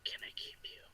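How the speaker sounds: soft, unvoiced whisper in the ear; ghostly whisper. Ghost cat whispering to a lucky listener, whispered. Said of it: "will whisper in your ear".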